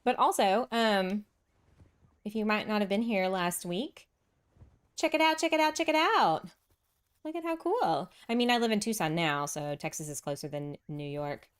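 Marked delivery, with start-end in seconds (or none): 5.0-8.1 higher, chanting plug; hyped-up plug. Real, not acted. Streamer hyping last week's build to viewers. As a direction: sell it to the chat with a chant.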